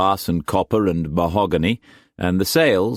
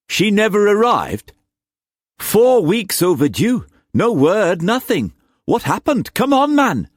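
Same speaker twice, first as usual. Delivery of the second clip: loud, high, agitated questioning; urgent alarm; 5 dB louder than usual. Acted. Captain's news is missing, demanding it in alarm. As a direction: demand answers, pitch climbing to the last word.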